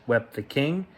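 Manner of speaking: ordinary delivery, nothing marked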